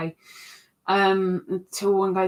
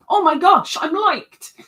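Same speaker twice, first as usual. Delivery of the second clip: much higher, louder giddy squeal; mock giddy; 8 semitones higher, 7 dB louder than usual. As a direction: gush like a smitten fool. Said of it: "They like me".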